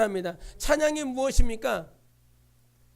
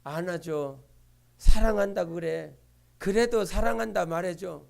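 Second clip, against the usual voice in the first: gentle voice of the Lord; 7 semitones lower than usual. Retold or imitated. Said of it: "주님이 안으라고 그러네".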